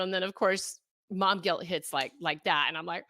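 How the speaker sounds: usual speaking voice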